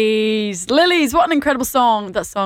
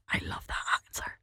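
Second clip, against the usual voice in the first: hushed, breathy aside to listeners; soft whispered delight; 17 dB quieter than usual. Real, not acted. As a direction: lean in and whisper it like a secret. Host reacts to listener's answer.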